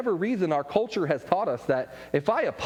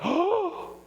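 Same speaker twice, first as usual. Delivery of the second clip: very high, gasping outrage; a dad who can't believe his ears; mock horror pitched way up; shocked disbelief; 13 semitones higher, much slower than usual.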